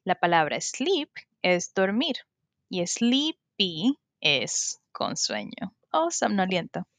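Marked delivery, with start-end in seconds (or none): 2.9-4.0 "sleepy" split slowly into "slee-py"; syllable by syllable. Shown as a demonstration. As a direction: sound out "sleepy" in two drawn-out syllables. Teacher breaks down the new word. ("sleepy" separado en sílabas, "slii-pi")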